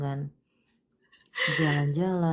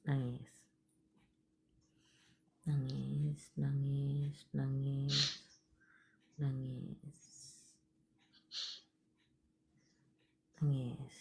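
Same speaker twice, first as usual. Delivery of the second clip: soft coaxing; 8 dB quieter, much slower than usual. Real, not acted. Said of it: "nangis dulu".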